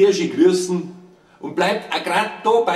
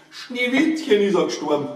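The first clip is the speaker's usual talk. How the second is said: lightly voiced dwarfs, pitched up; dwarfs' announcement; 4 semitones higher than usual. Dwarfs' message in verse tale.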